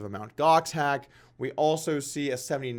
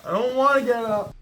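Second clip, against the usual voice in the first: put-on high, louder childish whine; whiny kid; 8 semitones higher, 5 dB louder than usual. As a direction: whine like a kid refusing bed. Whiny repeat, higher and louder.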